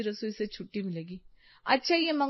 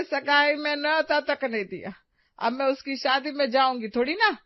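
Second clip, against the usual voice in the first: ordinary delivery, nothing marked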